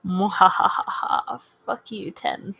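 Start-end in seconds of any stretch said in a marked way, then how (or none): 0.1-1.4 slow evil cackle; slow, drawn-out villain laugh; mad scientist laugh. Acted. Gloating over a good roll.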